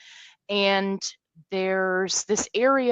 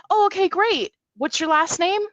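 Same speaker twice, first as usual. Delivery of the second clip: high, bright helper voice; a cheerful event worker checking names; chirpy staffer; 10 semitones higher, 4 dB louder than usual. Retold or imitated. Headset staffer greets the group in chirpy high voice. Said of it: "she's like".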